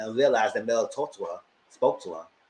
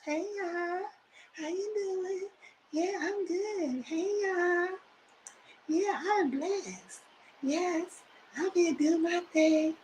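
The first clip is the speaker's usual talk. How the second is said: very high, soft, slow little voice; Mel's whispery talk; 7 dB quieter, much slower than usual. Retold or imitated. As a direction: mimic a tiny, drawn-out whispery voice. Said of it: "That's how Mel talk".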